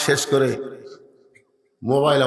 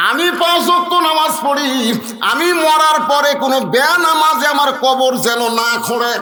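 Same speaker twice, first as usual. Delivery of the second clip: high, loud, emphatic declaration; full-force preaching; 11 semitones higher, 5 dB louder than usual. Acted. Preacher voicing a worshipper's last wish, high forceful preaching. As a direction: declare it forcefully at a raised pitch.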